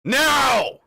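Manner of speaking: sudden high, loud shout; barked order. Acted. Game master as officer ordering the party to briefing, sharp shout. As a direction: bellow one drawn-out command.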